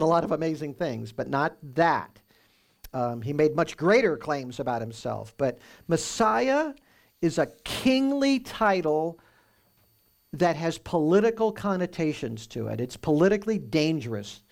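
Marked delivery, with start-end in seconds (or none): 5.4-9.2 "Messiah" high and slow; stress on Messiah. Shown as a demonstration. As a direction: lift and stretch "Messiah". Teacher stresses key word.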